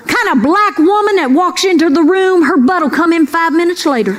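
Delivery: ordinary talk, nothing marked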